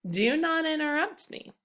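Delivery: firm, high command with a full stop; sharp stern order. Shown as a demonstration. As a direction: say it like a firm command that ends flat. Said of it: "That's how we know you're done speaking".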